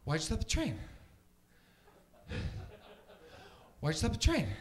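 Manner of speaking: winded, incredulous actor impression, jumping up on the last word; Mark Wahlberg. Comic mimics a movie hero questioning the train crew, breathless impression. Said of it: "here's my impression of Mark Wahlberg".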